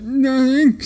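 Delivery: squeaky high, loud innocent denial; weird put-on voice. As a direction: keep the strange voice going. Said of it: "why is your voice doing that?".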